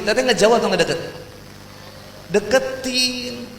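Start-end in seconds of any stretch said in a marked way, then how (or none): 2.3-3.5 a playful long "deketin" pulled out high; drawn-out answer. Acted. Preacher teasing the audience with a slow answer. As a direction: stretch it slowly, teasing and higher.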